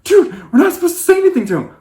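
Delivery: high, fast scolding whisper-shout; exasperated scold. Retold or imitated. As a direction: rush it out high, annoyed at him. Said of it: "We're like".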